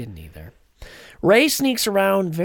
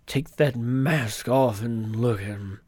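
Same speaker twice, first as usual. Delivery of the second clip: low, slow, grave order; stern command; 8 semitones lower, 5 dB quieter, slower than usual. Retold or imitated. Retelling the bridge confrontation, low and slow. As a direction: lay down the order, slow and heavy. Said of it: "he's like".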